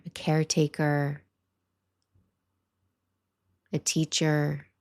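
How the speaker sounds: calm meditation voice with long pauses; guided meditation. Acted. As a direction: guide listeners gently, leaving space between phrases.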